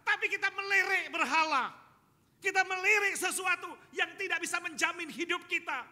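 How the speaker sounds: high, loud, impassioned proclamation; full-force preaching. Real, not acted. Preacher rebuking congregation for glancing at idols, full-force shouting. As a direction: thunder it out to the congregation without letting up.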